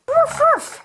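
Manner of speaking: high, squeaky little puppy woof; puppy barking. Pretend. Toy pup barking at the kids, a squeaky high woof.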